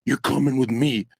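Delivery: low imitated officer voice; police officer. Officer arresting the streamer in the story, imitated low command. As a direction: imitate an officer, pitched well down.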